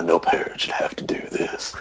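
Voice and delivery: acted near-whisper; hushed, grave. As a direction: murmur it softly, playing solemn. Retold mercy killing in game.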